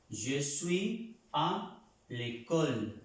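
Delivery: Je suis à l'école stretched out with weight on suis; very slow model. Shown as a demonstration. Teacher recapping for students, very slow l'école. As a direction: drill it slowly for the class. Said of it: "En este caso es de estar".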